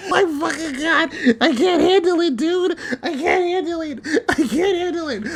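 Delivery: high, tearful wail; real upset, repeating it in a high whine; about to cry. Real, not acted. Fan overwhelmed by the story, high and tearful. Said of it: "I'm about to cry".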